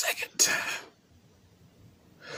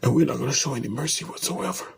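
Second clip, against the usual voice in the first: rapid, plain-voiced "not gonna show any mercy"; fast vow; much faster than usual. Real, not acted. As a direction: rattle the vow off quickly at normal volume. Vow said aloud then whispered.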